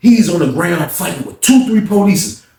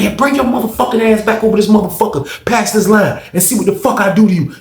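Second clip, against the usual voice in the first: high, heated mock dare; acted angry threat; 8 semitones higher than usual. Officer with a pump daring the inmate, angry. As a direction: act out her threat, pitched up.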